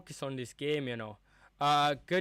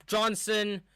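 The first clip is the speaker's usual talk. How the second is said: excited call; 8 semitones higher, 5 dB louder than usual. Real, not acted. Attacking move during live match.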